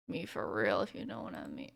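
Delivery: low, soft deadpan aside; deadpan mumble. Real, not acted. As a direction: throw it away darkly. Dark joke about dying.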